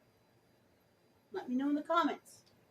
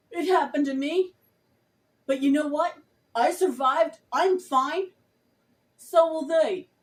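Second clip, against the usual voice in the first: mock abuser excuse; 3 semitones higher, 7 dB louder than usual. Mocking abuser's excuse, acted quote.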